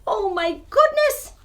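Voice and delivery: usual speaking voice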